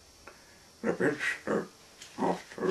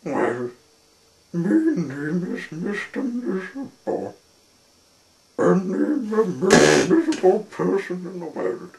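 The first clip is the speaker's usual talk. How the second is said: loud, high miserable wail; sad, squeaky character; 9 semitones higher, 11 dB louder than usual. A narrator's reading. Mister Miserable introduces himself to Hooper, high sorrowful squeak. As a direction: lament loudly in a high voice. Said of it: "little sad and a little squeaky".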